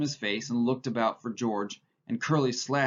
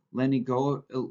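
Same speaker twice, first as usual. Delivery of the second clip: ordinary delivery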